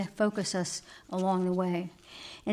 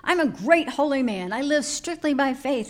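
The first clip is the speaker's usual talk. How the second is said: loud, higher indignant complaint; an offended holy man, raised voice; angry grumble; 6 semitones higher, 4 dB louder than usual. Acted. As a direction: protest your own holiness angrily. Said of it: "Angry, he grumbled".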